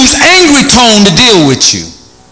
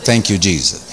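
murmured aside; 14 semitones lower, 10 dB quieter than usual. Real, not acted. Preacher's aside between points.

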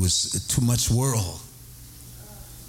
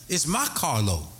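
ordinary talk, nothing marked

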